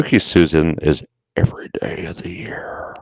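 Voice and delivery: eerie unvoiced whisper; spooky whisper. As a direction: breathe it out like a ghost. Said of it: "that was great".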